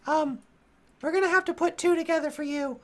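very high-pitched fussing gnome voice; squeaky, concerned little gnome; older gnome. Acted. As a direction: fret over them as an old gnome. Gnome staff arranging a bed.

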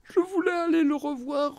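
high, tearful and pleading; sobbing daughter. Acted. Daughter sobbing to the nurse on the phone, tearful. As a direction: cry out the regret. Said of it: "Elle sanglota de plus belle".

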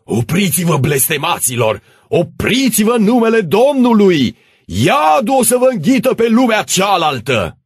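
high-pitched, thundering damnation; frantic shouting. Acted. Priest blocking the rebels' road, frantic shouting. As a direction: cry out a desperate curse at the mob. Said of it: "strigând cu ochii ieșindu-i din cap".

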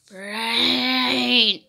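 long, low zombie moan; play zombie voice. Pretend. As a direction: stretch the word into a zombie groan. Zombie groans.

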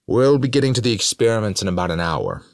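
high, loud public-address delivery; Morrison addressing guests. A narrator's reading. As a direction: project it to a hall, pitched well up.

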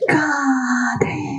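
slow drawn-out wailing shout, rising; hammed-up lovesick cry, stretched long; strong passionate cry. Told to say love strongly, he wails it out. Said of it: "நல்ல ஸ்ட்ராங்லியா சொல்லுங்க".

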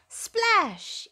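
high splashy exclamation falling away; splash sound. A narrator's reading. Narrator to child listeners, a bright high Splash. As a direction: call out the splash like a sound effect.

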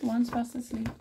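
plain reading voice

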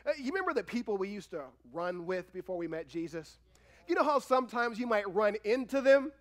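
ordinary talk

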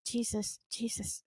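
whispered plea under the breath; soft desperate Jesus; hushed prayer. Retold or imitated. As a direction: pray quietly, terrified. Silent terrified prayer in head.

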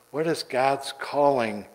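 ordinary talk

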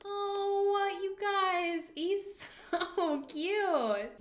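high, drawn-out gushing; adoring coo. Real, not acted. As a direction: melt over a cute puppy. Gushing over cute game dog.

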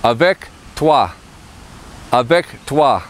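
avec toi, said ah-vek twah; French pronunciation. Shown as a demonstration. Teacher models avec toi, careful French. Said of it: "let's learn how to say "with you".

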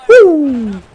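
very high, loud woo; jubilant whoop. Real, not acted. Gamer celebrating a power-play goal, loud whoop. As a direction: let out a victory whoop.

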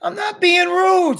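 very high, loud, slow whine of denial; indignant protest. A narrator's reading. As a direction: protest loudly that you're blameless. Julian protests against the scolding.

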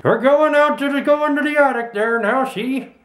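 lightly voiced old prospector; a hint of an old gold miner. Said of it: "I was trying to do, like, I'm an old prospector".

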